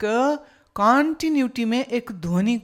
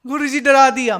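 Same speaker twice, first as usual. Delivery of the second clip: mock startled complaint; 5 semitones higher, 8 dB louder, faster than usual. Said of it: "वो कितनी देर तक ऐसे ही".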